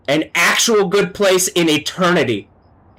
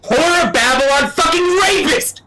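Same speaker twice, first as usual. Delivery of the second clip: a sudden higher-pitched furious outburst; angry outburst; 11 semitones higher than usual. Real, not acted. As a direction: pitch jumps up on an angry cry. Furious condemnation of a spirit.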